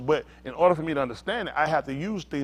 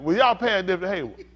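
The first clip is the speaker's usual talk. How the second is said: ordinary talk, nothing marked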